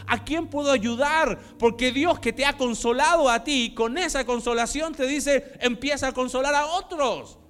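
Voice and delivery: loud, high, impassioned sermon voice; loud preaching with rising passion; fiery preaching. Acted. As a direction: preach it full force to the congregation. Preaches at full force.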